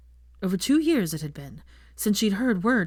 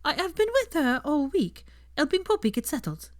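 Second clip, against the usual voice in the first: higher, timid Fleur voice; shy and hesitant; 10 semitones higher than usual. A narrator's reading. Fleur tells Hermione of her week, higher and timid. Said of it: "her tone usually timid".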